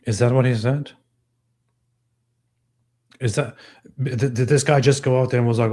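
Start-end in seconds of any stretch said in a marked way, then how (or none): none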